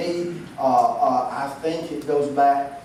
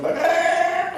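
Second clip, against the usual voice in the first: excited. Real, not acted. Excited recall of doll.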